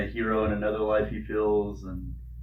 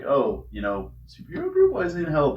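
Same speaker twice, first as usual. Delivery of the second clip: puzzled surprise; 7 semitones higher than usual. Retold or imitated. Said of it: "which at first I was like".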